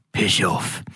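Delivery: low, lightly voiced old barfly; a hint of surly old man; strange old man. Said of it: "strange old man in the corner of the bar".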